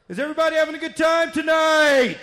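high, loud MC holler; crowd hype shout. Pretend. Contestant working the casino crowd, hyped-up shout. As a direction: fire up a big crowd.

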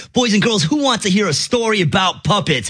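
put-on high bright kids show host; overbright kids party host. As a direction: pitch it up for kids. Addresses kids audience.

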